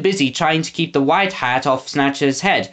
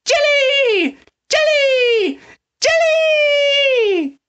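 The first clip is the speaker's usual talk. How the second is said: distant shouting; much slower than usual. A narrator's reading. A voice from the truck, shouting the word. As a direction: call out from far off again and again. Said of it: "shouting a single word".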